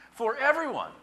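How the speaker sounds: "For everyone" pitched very high and loud; emphatic exclamation. Real, not acted. Preacher exclaiming the last phrase, emphatic.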